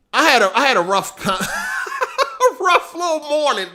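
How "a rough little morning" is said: high, laughing through the words; helpless laugh stretching the words. Real, not acted. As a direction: crack up midway and squeeze the phrase out. Breaks up laughing at own morning.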